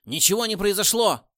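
high, loud angry yell; furious shout. A narrator's reading. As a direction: explode in rage. Boss finds nothing happened.

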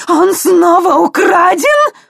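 high, slow screaming rage; furious shriek. Acted. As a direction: shriek it in fury. Old woman enraged at loss.

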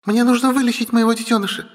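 put-on high, insistent young female figure; angry insistence. Sister demands a cure for her baby, high and insistent.

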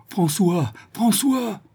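high desperate shouted name; crying out. A narrator's reading. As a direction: call him through the empty house. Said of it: "en criant".

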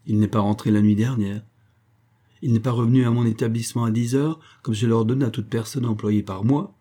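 plain reading voice

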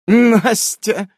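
high, loud character echo; amused echo. A narrator's reading. Nasa smirks at the player's word, high amused echo. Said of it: "усмехается Наса".

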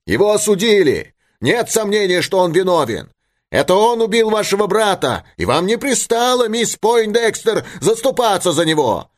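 high, heated accusation; shout from the crowd. A narrator's reading. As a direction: cry it out as a protester in a crowd. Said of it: "закричал голос из толпы". (гневный выкрик из толпы, высоко)

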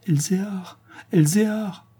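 high-pitched, bewildered calling of a name; distraught cry. A narrator's reading. Dazed woman crying for her lost Elzéar, distraught. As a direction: cry out the name as if half delirious. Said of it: "s'écriait l'infortunée encore en proie à une sorte d'égarement".

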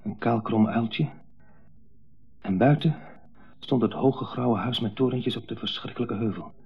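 ordinary talk, nothing marked

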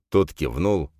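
ordinary delivery

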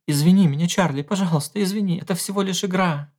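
high, pleading and hurried; the wife apologizes to her sobbing husband; tearful apology. A narrator's reading. Wife comforting crying husband.

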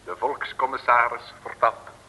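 slow, measured title read; formal radio announcer. Acted. Announcer names episode.

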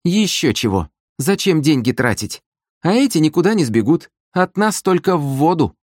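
high, dismissive sister voice; cocky sister. A narrator's reading. Sister refusing to pay oarsmen, high and dismissive. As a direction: scoff in a high, cheeky voice.

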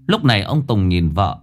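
plain reading voice

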